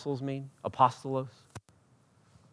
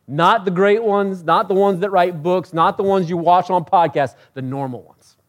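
raised, loud rhythmic list; impassioned preaching; 7 semitones higher, 8 dB louder than usual. Acted. Preacher telling ordinary people God chooses them, impassioned. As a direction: hammer each "not" from the pulpit.

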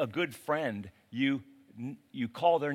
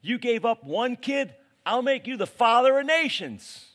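much higher, louder, building eagerness; excited promise; 10 semitones higher, 7 dB louder than usual. Acted. God promising Abraham nations, loud excited promise. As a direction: let the promise burst out of you.

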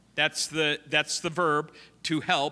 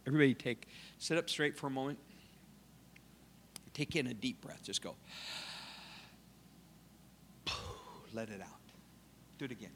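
much softer, low breathing guidance; guiding breathing; 4 semitones lower, 14 dB quieter, much faster than usual. Shown as a demonstration. Preacher leading congregation to breathe, soft guided instruction. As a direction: lead the room through a breath. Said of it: "Let's all try that real quick".

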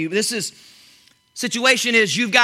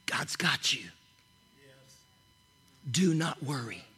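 soft unvoiced whisper, slowing right down; hushed whisper; 8 semitones lower, 11 dB quieter than usual. Real, not acted. Preacher calms the congregation after shouting, whispering. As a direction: breathe the words, calm and low. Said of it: "I'm asking you to slow down".

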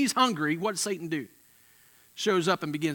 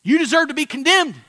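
much higher and louder, a condemning shout; accusing shout; 12 semitones higher, 12 dB louder than usual. Pretend. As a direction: hurl the accusation like the devil. Accuser voiced condemning the believer.

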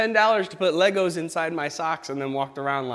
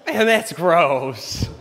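slow, loud, laughing through gross; laughing disgust; 3 semitones higher, 8 dB louder, much slower than usual. Acted. Pastor reacting to eating a used Band-Aid, slow laughing disgust.

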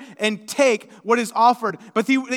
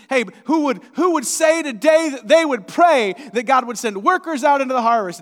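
louder, higher rally call to the pews; preacher's call; 5 semitones higher, 8 dB louder than usual. Retold or imitated. Imitating his own sermon, calling the congregation to pray. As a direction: rouse the congregation to commit. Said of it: "I think I've preached it".

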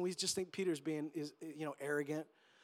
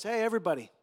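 upbeat crowd greeting building to a loud proclamation; hammed-up pep talk delivery; guest speaker; 3 semitones higher, 8 dB louder than usual. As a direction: announce it cheerfully, getting louder. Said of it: "The one that gets up and goes".

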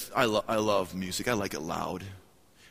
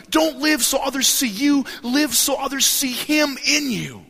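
higher, louder, pleading sermon voice; fervent preaching; 14 semitones higher, 8 dB louder than usual. Acted. Preacher urging the church, high and loud. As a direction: plead with the church, slow and strong.

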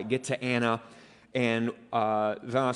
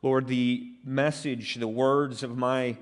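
earnest prayer. Real, not acted. Pastor leads congregation in prayer.

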